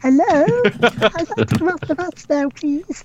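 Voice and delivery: put-on high, small stranded-kid voice; timid kid voice. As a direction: plead politely like a forgotten child. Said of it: "It's like".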